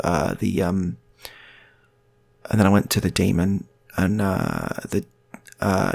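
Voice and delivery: halting, droning delivery with long ums; rambling hesitant storyteller, stretched fillers; bad storyteller. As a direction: keep losing your thread and restarting, slowly. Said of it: "he tells stories exactly like this".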